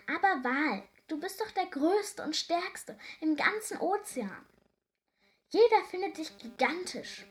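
high, lively storybook praise; emphatic encouragement. A narrator's reading. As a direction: build him up with enthusiasm. Doctor cheers up the sad whale.

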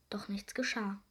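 ordinary delivery

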